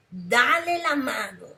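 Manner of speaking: thunderous devil voice ordering; horrible thundering voice. Retold or imitated. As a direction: boom it out like the devil. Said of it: "una voz horrible, así estrondosa".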